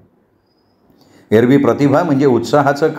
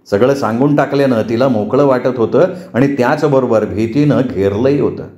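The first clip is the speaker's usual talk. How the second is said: ordinary delivery, nothing marked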